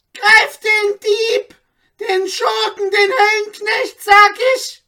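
very high, loud screaming order; frantic command. A narrator's reading. The magistrate screaming at hesitant guards, a very high frantic order. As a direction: shriek at the guards to seize him. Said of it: "rief der Viertelsrichter".

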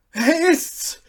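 high, loud recognition; excited cry. A narrator's reading. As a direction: burst out, sure of it. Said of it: "rief der Oberstallmeister".

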